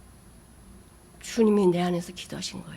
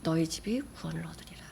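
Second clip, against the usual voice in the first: hushed scripture whisper; 6 semitones lower, 12 dB quieter than usual. Acted. Preacher to congregation, whispering the promise softly. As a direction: whisper the promise, barely voiced.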